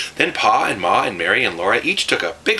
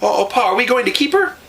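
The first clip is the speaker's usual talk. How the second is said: excited shout; 13 semitones higher than usual. A narrator's reading. Girl running to Pa asking to keep the cow, excited shout. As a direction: shout hopefully as you run.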